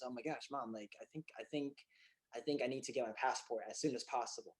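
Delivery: soft, halting retold confession to mom; understated, tentative amazement; hushed disbelief. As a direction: say it quietly, as if barely daring to believe it. Retelling hushed news to his mom.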